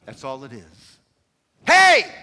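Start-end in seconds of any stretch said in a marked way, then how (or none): 1.7-2.1 sudden high, loud holler; bellowing angel. Acted. Angel calling to shepherds on the hillside, bellowing. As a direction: shout to grab their attention. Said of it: "bellowing out this noise".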